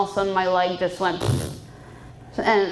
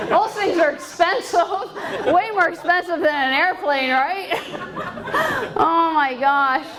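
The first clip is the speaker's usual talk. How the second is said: laughing through the words, voice pitched up; 8 semitones higher than usual. Real, not acted. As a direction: giggle your way through the punchline. Laughing at her own joke.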